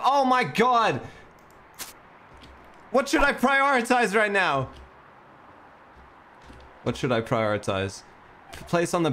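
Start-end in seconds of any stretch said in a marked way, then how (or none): 0.0-1.0 high, loud outburst of panic; panicked cry. Real, not acted. Panics as time runs out. 2.9-4.7 high, loud frantic question; panicked yell. Real, not acted. Panics over too many tasks.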